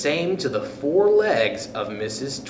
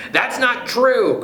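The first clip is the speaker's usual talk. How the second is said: higher, slow and a little louder; shrill protesting cry; 10 semitones higher, 4 dB louder than usual. Acted. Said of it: "cried Missus Twit".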